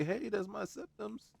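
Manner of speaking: high, slow put-on guard voice; a hammed-up high guard protesting; mock guard voice. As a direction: squeak it out like an offended guard. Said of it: "standing there going like".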